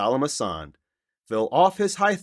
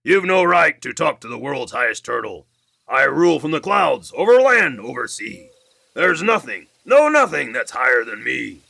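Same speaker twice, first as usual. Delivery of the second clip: louder, proud scolding king; haughty king Yertle; 3 semitones higher, 7 dB louder than usual. Acted. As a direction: lord it over the turtle below. Yertle rebukes Mac.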